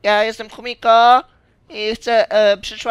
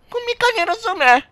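high protest; 11 semitones higher than usual. Acted. Repeated protest.

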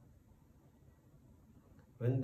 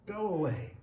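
high-pitched shout of dismissal; indignant, higher-pitched cry; 8 semitones higher, 4 dB louder than usual. A narrator's reading. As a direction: shoo it off, voice raised high. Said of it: "shouted the farmer".

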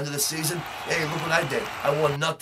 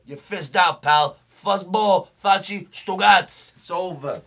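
much louder and higher, building to a roar; yelled threat; 5 semitones higher, 8 dB louder, slower than usual. Acted. Gangster character yelling his kiss-off at rival Fizzy, loud and high. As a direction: shout the kiss-off at your rival.

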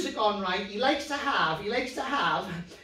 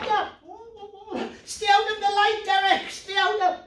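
mock panicked pleading; 9 semitones higher than usual. Pretending puppet won't wake.

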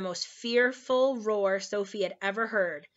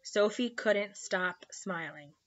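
plain reading voice

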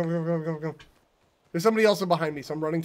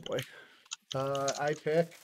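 ordinary talk, nothing marked

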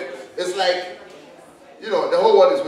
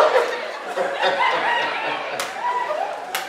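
high shrieking laugh; 5 dB quieter than usual. Real, not acted. Comedian breaks up laughing.

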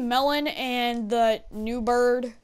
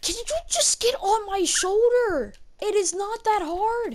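exasperated; 9 semitones higher than usual. Real, not acted. Exasperated with pet parrot.

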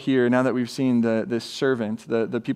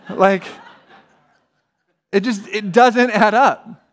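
laughing; 6 semitones higher, 5 dB louder than usual. Real, not acted. Laughing at absurd idea.

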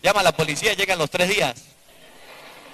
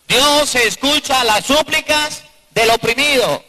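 slow, raised preaching of the verse; 6 semitones higher, 4 dB louder, slower than usual. Acted. Preacher proclaims scripture slowly to the congregation.

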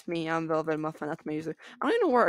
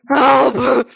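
loud, higher drawn-out yell; panicked cry for help; 5 semitones higher, 14 dB louder than usual. Pretend. Calling out in game panic.

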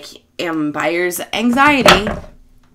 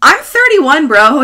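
higher, louder indignant announcement; mock exasperated outburst; 9 semitones higher, 11 dB louder, slower than usual. Telling the young guy her age, loud and exasperated. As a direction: blurt the age at him, exasperated. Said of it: "And I was like".

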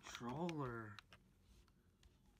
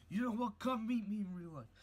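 loud challenge; 5 semitones higher, 7 dB louder than usual. Acted.

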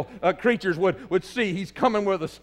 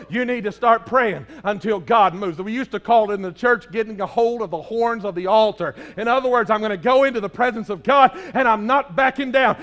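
ordinary delivery

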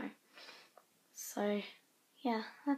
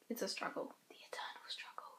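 mock-dramatic whisper; 2 semitones lower, 11 dB quieter than usual. Real, not acted. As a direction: whisper it like doom.

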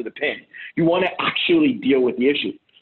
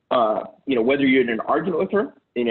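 ordinary talk

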